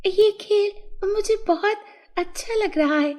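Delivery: high fairy voice, eager request; naughty fairy. A narrator's reading. Fairy asks the boy to teach her, high eager voice. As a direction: pitch it up like a keen little fairy. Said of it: "नटखट परी बोली".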